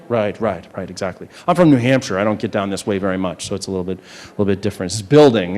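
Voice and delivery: ordinary delivery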